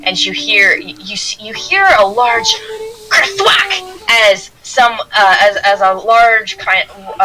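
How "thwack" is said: sudden impact noise. Pretend. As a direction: voice the sound of a heavy blow. Imitates a loud crashing hit.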